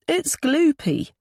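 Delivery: put-on high, drawn-out child's voice; little boy. A narrator's reading. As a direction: complain slowly like a small boy. Young boy objects to sauce.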